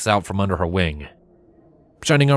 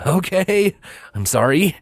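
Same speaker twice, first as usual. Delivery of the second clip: a touch higher, quick hurried sorry; nervous apology; 11 semitones higher than usual. A narrator's reading. Shining Armor nervously apologizing to his wife. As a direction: back down fast and nervous. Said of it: "said Shining Armor nervously".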